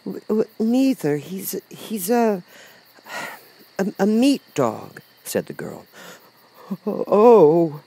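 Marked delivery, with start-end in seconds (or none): 0.0-5.0 put-on high-pitched, halting little girl; Dorothy, hesitant. A narrator's reading. Dorothy gropes for words. 6.8-7.8 slow, high, stammering lion; Cowardly Lion, puzzled. A narrator's reading. Lion reacts.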